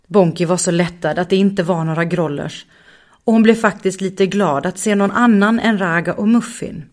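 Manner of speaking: usual speaking voice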